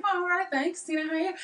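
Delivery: high, lilting put-on Australian; American faking a cheery Australian reply; fake Aussie accent. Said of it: "totally fake being an Aussie".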